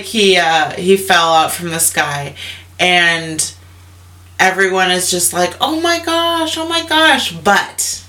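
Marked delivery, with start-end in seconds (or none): 5.6-7.3 high, breathless mock shock; a much higher oh my gosh; shocked family. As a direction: gush over the fall in disbelief. Family sees him fall.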